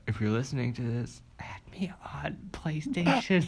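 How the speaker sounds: soft, whispered aside; mock secret plea. Co-host asking listeners to befriend him, whispered.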